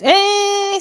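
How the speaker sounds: high loud motor whine; imitated motor whine. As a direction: whine like a starting tool. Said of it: "it goes".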